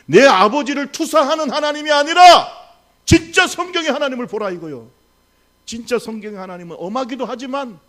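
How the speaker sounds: loud, high impassioned preaching; preaching climax. Acted. Pastor crying out to the congregation, impassioned loud preaching.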